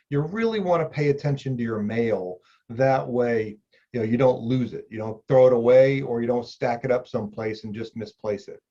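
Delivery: usual speaking voice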